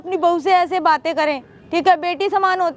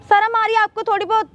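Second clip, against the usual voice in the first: high-pitched, scandalised scolding; indignant outrage; 4 semitones higher than usual. Acted. Daughter-in-law rebuking father-in-law over his money offer, outraged. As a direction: shame him with rising indignation.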